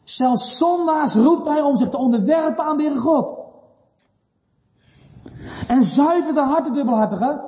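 high-pitched, pressing exhortation; emphatic preaching. Real, not acted. Preacher urging the double-hearted to bow, emphatic preaching.

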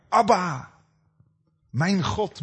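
high, loud cry of Jesus forsaken on the cross; anguished cry. Acted. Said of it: "En Jezus riep als het ware".

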